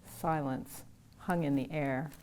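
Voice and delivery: usual speaking voice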